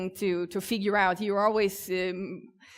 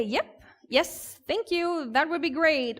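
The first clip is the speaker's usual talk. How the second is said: higher-pitched, eager customer answering; lightly acted, agreeable high-pitched user; chirpy user reply; 7 semitones higher, slower than usual. User accepting the pizza offer from a voice assistant, lightly acted chirpy reply. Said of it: "if the user say".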